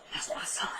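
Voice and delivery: retold grief, barely getting it out; about to cry. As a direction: let the words collapse into a tearful whisper. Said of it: "And she starts crying".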